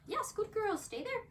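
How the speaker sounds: bright reward then hold her; sing-song yes and stay; high praise. Real, not acted.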